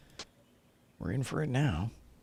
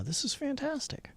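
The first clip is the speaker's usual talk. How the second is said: excited squeal; 4 dB quieter than usual. Real, not acted. Streamer thrilled dual stream works.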